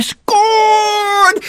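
squealing with joy, high and stretched out; excited scream. A narrator's reading. Storyteller cheering the winning score, thrilled and shrill. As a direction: shout the goal like a thrilled child. Climax of the toy football game.

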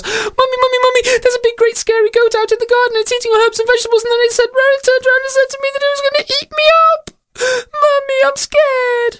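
put-on very high, fast, breathless child's squeal; terrified little girl. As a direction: gabble in panic to your mummy, then wail. Storyteller voices frightened girl.

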